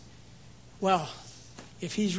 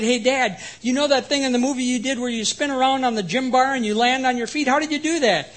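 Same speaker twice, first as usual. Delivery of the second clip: put-on higher and louder, an eager little boy; excited child voice; 6 semitones higher, 7 dB louder than usual. Six-year-old son asking his movie-star dad, excited child voice.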